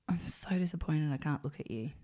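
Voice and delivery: dad's hushed verdict; disappointed whisper. Retold or imitated. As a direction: whisper it like he can't bear to look. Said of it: "And it was in that tone".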